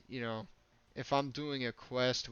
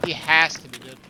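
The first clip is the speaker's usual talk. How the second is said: tense shout; 2 semitones higher, 15 dB louder than usual. Real, not acted. Tense raid firefight.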